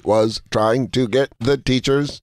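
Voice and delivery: one word at a time, mechanically; mock talking-machine delivery; Speak and Spell. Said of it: "Speak and Spell over here".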